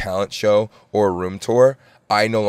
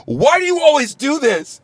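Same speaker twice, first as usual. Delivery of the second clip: high, slow whiny mock of a crying girlfriend; mock tearful complaint; upset girlfriend. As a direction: imitate her wailing complaint, pitched way up. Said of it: "And then she's like".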